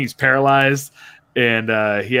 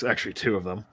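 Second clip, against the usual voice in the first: usual speaking voice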